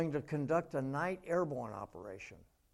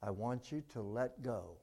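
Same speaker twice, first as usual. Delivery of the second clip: low, softer, unhurried divine voice; calm voice of God; 4 semitones lower, 8 dB quieter than usual. Acted. God tells the man to let go, low and calm. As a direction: speak down from heaven, serene and lower.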